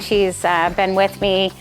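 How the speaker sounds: ordinary delivery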